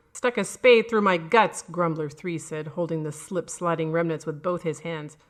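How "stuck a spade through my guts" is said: loud and high; angry accusation. A narrator's reading. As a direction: throw the blame at him loudly. Dead man accusing.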